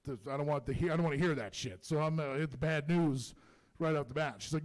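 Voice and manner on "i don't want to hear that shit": ordinary talk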